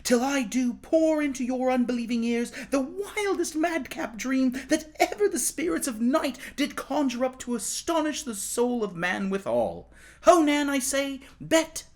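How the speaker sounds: put-on high, eager boy's voice calling out; a young pauper boy summoning his sisters, high and excited. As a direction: call the sisters over, bursting with news. Tom calls sisters in dream.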